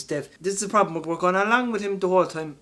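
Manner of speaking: ordinary delivery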